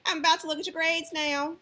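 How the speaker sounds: mock a threatening parent checking grades; sarcastic threat. Shown as a demonstration. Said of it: "that's two different things that you're communicating".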